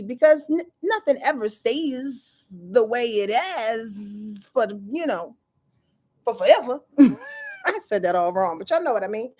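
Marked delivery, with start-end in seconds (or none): none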